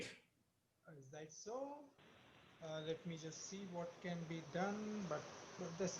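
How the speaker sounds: very faint voice; barely audible. Real, not acted. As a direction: far from the mic, hardly heard. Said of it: "your voice is very faint".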